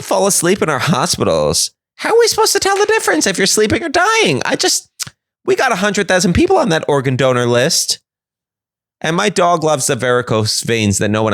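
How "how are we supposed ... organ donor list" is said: much higher, defensive whine; high exasperated spokesperson. Acted. Hospital spokesperson defending itself to the public, high and exasperated. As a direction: throw up your hands.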